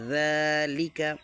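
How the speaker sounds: যালিকা slowly with a long first vowel; lengthened zal. Shown as a demonstration. Teacher stretching the letter for students. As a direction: drag out the যা. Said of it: "জাল বাড়ছে".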